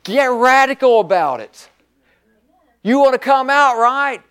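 high, forceful preaching; fired-up preaching. Real, not acted. Preacher urging the saints to get radical, fired-up preaching.

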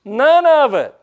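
high, loud, blunt answer; emphatic shout. Retold or imitated. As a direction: blurt it out loudly. Retold blunt answer to God.

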